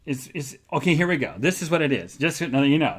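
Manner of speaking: ordinary talk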